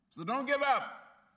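loud, high and slow; a preacher's closing cry, high and stretched; rallying shout. Real, not acted. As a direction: drive it home to the crowd, slow and big.

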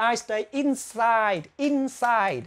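slow teaching read of "inside" with a clear "I" sound; slow careful classroom model; slow demo. Shown as a demonstration. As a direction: spell it out slowly for students. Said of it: "cái vần này cũng hay đọc là I".

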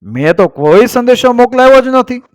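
high-pitched, surprised denial; storyteller's astonished king. A narrator's reading. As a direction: protest in amazement, voice lifted high. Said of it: "રાજાએ આશ્ચર્યથી કહ્યું".

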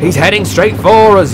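high, loud yell of alarm; alarmed fireman, pitched right up. A narrator's reading. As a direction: yell a warning of incoming attack. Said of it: "yelled the fireman".

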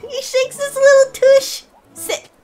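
high, loud squeal at something adorable; giddy cuteness overload; gushing delight. Real, not acted. Gushing over a cute animation.

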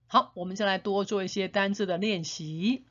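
ordinary delivery

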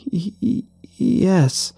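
put-on frightened stammer to a haunted old man; nervous stammer. A narrator's reading. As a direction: stutter it out, scared. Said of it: "I stammered".

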